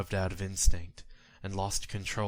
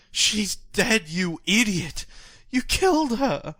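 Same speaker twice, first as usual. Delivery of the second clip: enraged scream, much higher and louder; furious old man; 14 semitones higher, 10 dB louder, slower than usual. Acted. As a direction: hurl the blame at the driver like a grieving old man. Old man accuses driver.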